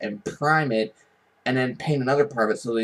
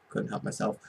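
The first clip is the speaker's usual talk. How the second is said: ordinary talk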